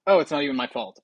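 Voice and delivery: usual speaking voice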